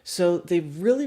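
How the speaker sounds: ordinary talk, nothing marked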